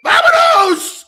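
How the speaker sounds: very high, loud drawn-out yell; mock doorbell shout. Streamer showing what the doorbell blurts out, exaggerated shout. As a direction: blare it out comically like a doorbell speaker. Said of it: "you're looking at a doorbell and it's going".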